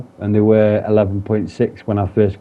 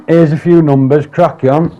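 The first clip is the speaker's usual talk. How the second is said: higher, louder mocking of a dismissive official; mock brush-off; 6 semitones higher, 6 dB louder than usual. Mimicking the helpline worker brushing him off, mockingly. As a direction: toss it off like someone getting rid of you.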